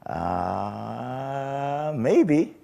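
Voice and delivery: put-on high, hesitant little-boy answer; a son unsure what to tell his dad, drawn out and high; sheepish kid. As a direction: mumble it like a guilty child. Sheepish child answering father.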